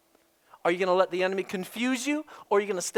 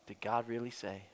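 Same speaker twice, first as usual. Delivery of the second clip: sighed, very soft and low; weary sigh; 13 semitones lower, 14 dB quieter than usual. Real, not acted. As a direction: let the doubt out on a tired sigh. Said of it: "what I deal with all the time".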